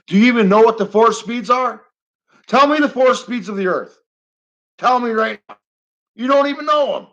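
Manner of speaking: loud, high, angry demands; really fed up, press the troll hard; getting mad. Real, not acted. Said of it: "I'm getting mad now".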